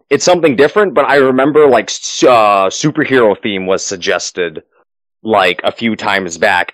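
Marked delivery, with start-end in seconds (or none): none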